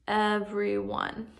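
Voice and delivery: put-on slow, clear reading for children; read together slowly. Shown as a demonstration. Teacher reading a new word slowly with first graders. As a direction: say it slowly so the class can follow. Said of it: "let's read it together".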